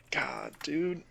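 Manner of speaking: normal talking voice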